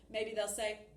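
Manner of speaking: usual speaking voice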